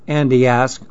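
plain reading voice

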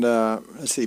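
usual speaking voice

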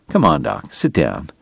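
quiet calming plea; soothing. Acted. Calming an upset friend.

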